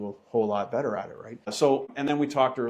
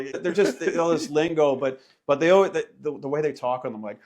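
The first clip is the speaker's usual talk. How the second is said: ordinary delivery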